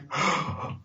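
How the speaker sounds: soft acted gasp before pleading; tearful gasp. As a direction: gasp in, imitating someone near tears. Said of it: "almost started crying".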